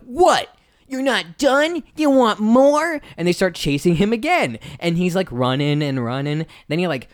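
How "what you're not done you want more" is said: high, drawn-out bully challenge; slow incredulous threat from a bully; taunting bullies. Acted. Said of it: "and they're like".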